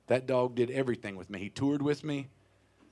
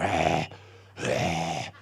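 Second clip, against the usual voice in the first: low, rasping dog wheeze; imitated heavy breathing; 10 semitones lower than usual. Comic showing girlfriend's bulldog breathing, heavy wheezy imitation. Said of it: "he's just breathing really heavy".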